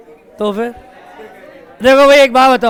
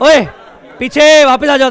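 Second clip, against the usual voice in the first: loud call; 4 semitones higher, 9 dB louder, slower than usual. Real, not acted. Calling student back to seat.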